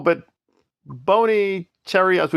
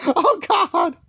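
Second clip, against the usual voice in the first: high falsetto mock sobbing parody; mock crying Ellie; 10 semitones higher than usual. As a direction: ham up a weepy overwrought victim. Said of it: "She wanna do this whole weirdo tangent".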